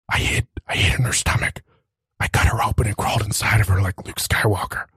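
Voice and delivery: whispered sad backstory; a frightened dragon confiding in a hush; dragon whispering. Acted. Dragon whispering his tragic past to the visitor. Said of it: "trying to keep his voice down".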